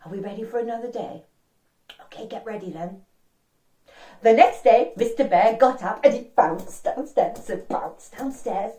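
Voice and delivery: ordinary delivery, nothing marked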